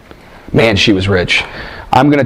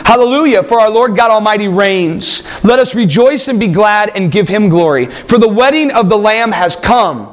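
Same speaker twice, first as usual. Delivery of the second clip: raised, ringing reading of the vision; scripture reading; 7 semitones higher than usual. Acted. Preacher reads Revelation aloud to the congregation, ringing and raised. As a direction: proclaim the text to the congregation.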